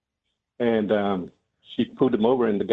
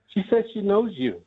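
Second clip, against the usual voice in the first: put-on wry old wife; 10 semitones higher than usual. Grandma's reply to her husband.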